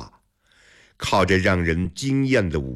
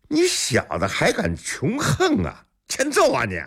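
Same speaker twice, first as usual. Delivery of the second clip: very high-pitched angry outburst; lackey spoiling for a fight; threatening bully; 13 semitones higher than usual. A narrator's reading. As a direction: threaten him in a high, riled voice.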